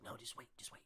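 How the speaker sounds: whispered, very soft pleas to wait; soft unvoiced whisper, wait; hushed urging. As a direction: breathe it out under the crowd noise. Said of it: "And he's like".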